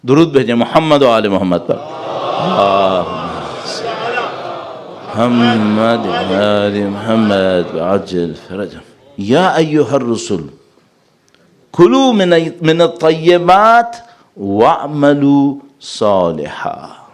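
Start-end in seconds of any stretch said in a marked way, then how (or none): 1.8-8.8 drawn-out devotional chant of the salawat; slow reverent recitation; slow recited blessing. Real, not acted. Leads salawat before recitation. 9.2-17.0 slow chanted Arabic verse; slow Quran recitation voice; Quranic recitation. Real, not acted.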